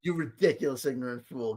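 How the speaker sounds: mock the narrator's doom voice; mock grave insult. Mocking repeat of clip.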